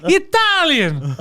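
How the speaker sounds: high, slow, loud stage-Italian voice; hammed-up Italian. Joking as an Italian to friends, high hammed-up accent. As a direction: ham up the Italian excuse.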